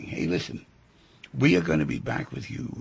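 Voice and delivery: normal talking voice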